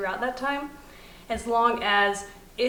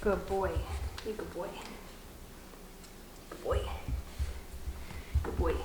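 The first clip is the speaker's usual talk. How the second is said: soft, lowered murmurs of praise to a puppy; 3 semitones lower, 10 dB quieter than usual. Real, not acted. Trainer settling puppy after the kennel, soft praise. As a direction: keep rewarding the dog quietly.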